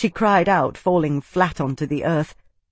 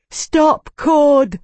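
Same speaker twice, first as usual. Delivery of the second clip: high, loud cry; shouting; 11 semitones higher, 7 dB louder than usual. A narrator's reading. Isana screaming at Cord to stop, loud. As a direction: yell it to stop him.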